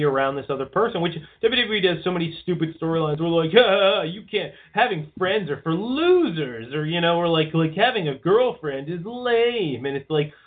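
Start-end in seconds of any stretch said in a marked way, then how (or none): 3.6-6.7 higher, drawn-out mock sneer; mocking imitation. Host mocking stupid wrestling storylines, mocking imitation. 7.7-9.9 higher, drawn-out mock sneer; mocking imitation. Host mocking stupid wrestling storylines, mocking imitation.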